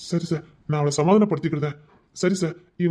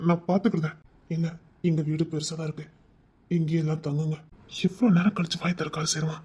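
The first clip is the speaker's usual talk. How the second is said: normal talking voice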